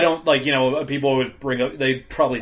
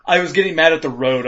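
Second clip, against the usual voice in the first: normal talking voice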